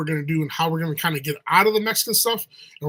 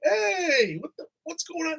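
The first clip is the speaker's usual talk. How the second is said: bewildered wonder; 11 semitones higher, slower than usual. Retold or imitated. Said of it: "you're like".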